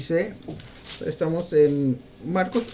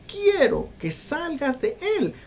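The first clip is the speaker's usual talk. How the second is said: slow, higher stern command, understated; commanding order; 9 semitones higher, slower than usual. Acted. Preacher voicing Jesus ordering the spirit out, slow and commanding. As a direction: give a slow firm order to leave. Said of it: "le está dando una orden".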